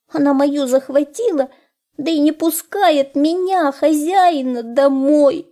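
high, plaintive hare voice; tearful hare. A narrator's reading. Hare telling the wolf his sad story, high and plaintive. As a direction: lament in a high little voice.

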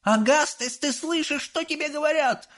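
very high scolding mother; mother scolding her son by the river; mother yelling. Acted. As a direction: yell at your disobedient son. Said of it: "заорала миссис Глуп".

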